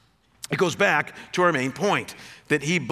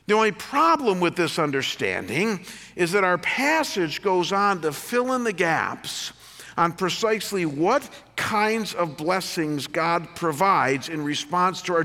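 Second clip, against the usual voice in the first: ordinary talk, nothing marked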